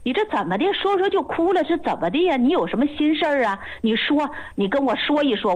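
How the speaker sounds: acting out an alarmed doctor coaxing a crying patient; startled concern. Master doctor to a patient who suddenly bursts into tears, startled and concerned. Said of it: "我师傅吓一哆嗦".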